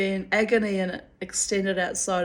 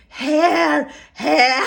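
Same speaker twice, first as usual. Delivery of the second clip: loud, laughing French doctor correcting; louder French accent, half laughing; French vet accent; 7 semitones higher, 8 dB louder than usual. Retold or imitated. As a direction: mimic the French vet, insisting on hair. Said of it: "So he went".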